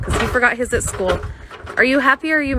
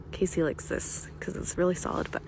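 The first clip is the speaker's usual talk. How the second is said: whispering; 3 semitones lower, 14 dB quieter than usual. Real, not acted. Aside about Casey's preference.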